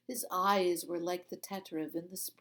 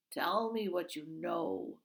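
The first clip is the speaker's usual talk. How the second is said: usual speaking voice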